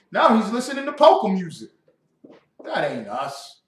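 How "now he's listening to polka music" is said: mocking disbelief. Preacher mocking the son.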